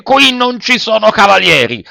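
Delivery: high, hard puppeteer reply; stern acted rebuff; harsh retort. A narrator's reading. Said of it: "replicò duramente".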